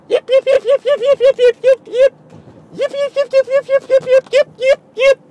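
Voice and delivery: very high, loud yapping yips; brother's yip yip. Retold or imitated. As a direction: yip like an excited little dog. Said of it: "he goes".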